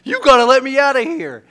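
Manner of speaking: high, loud desperate pleading; hammed-up panicky shout; mock panic plea. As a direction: beg loudly to be let out. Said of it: "that's what I'm".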